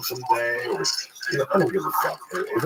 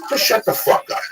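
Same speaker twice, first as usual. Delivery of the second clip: angry shout; 13 semitones higher, 5 dB louder than usual. Acted. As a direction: shut the fuck up shouted high and loud. Angry rant at critics.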